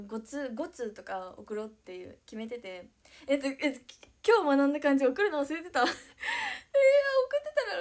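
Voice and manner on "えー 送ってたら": much higher and louder, slow, laughing through it; laughing teary. Real, not acted. Streamer laughs about the email she forgot to send. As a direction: crack up while talking.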